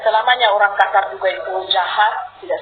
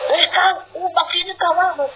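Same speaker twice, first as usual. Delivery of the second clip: much higher-pitched mock scolding; imitated rough speech; 9 semitones higher than usual. Preacher showing how Sulawesi people talk, coarse mock quarrel. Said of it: "Sulawesi ngomong saja kasar".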